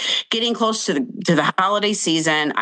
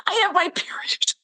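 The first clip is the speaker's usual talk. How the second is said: squeaky dismay; 14 semitones higher, 3 dB quieter than usual. Retold or imitated. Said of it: "I'm like".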